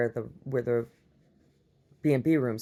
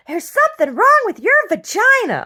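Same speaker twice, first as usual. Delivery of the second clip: much higher and loud, screeched like a campy movie character; quoting a John Waters film; 12 dB louder than usual. Retold or imitated. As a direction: belt the line out in a high camp film voice. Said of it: "that John Waters cracks me up".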